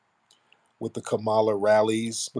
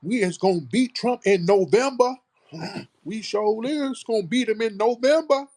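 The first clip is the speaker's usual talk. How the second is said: much higher, louder mocking put-on accent; shrill high parody of a politician's put-on accent; mock Kamala accent; 12 semitones higher, 4 dB louder than usual. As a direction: parody her fake down-home voice. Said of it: "talking with this F B A accent".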